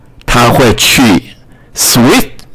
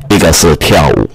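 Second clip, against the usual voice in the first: ordinary delivery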